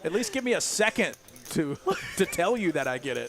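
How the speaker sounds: high, loud comic protest; mock exasperation. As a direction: protest loudly as if cut off mid-joke. Acted comeback to pushy joke teller.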